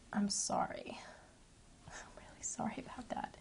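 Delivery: a soft, breathless whispered sorry with a laugh; whispered apology. Acted. Actress apologizing to fans for the show's cancellation, whispered. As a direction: confess it in a hush, half-laughing.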